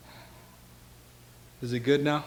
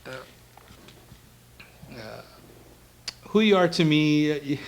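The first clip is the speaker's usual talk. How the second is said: ordinary talk, nothing marked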